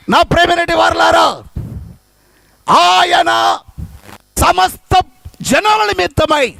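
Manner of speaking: fervent sermon delivery; preaching. Acted. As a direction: preach to the congregation. Preaching to congregation.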